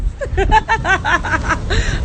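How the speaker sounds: high, rapid cackle; long high laugh. Real, not acted. Vlogger declaring she'll live her life, long high laugh.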